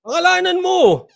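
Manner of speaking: high, loud mocking imitation. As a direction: push the pitch way up, mockingly. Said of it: "pataas-taas ka ng boses". (pakutyang pagtaas ng boses)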